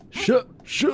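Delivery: high pitched yelp. Acted. Game sound, shout.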